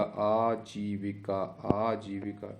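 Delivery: आजीविका drawn out syllable by syllable; very slow sounding-out. Shown as a demonstration. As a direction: stretch the long vowels of आजीविका. Teacher sounds out the spelling. (आजीविका को बहुत धीरे खींचकर)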